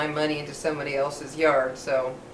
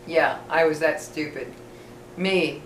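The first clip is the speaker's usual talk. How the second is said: ordinary talk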